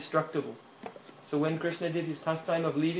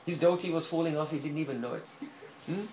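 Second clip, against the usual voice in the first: usual speaking voice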